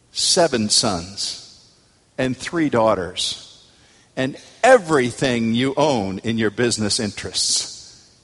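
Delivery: ordinary talk, nothing marked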